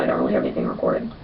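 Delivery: fast, breathy whisper. Real, not acted. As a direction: whisper it under your breath. Trailing off, whispered.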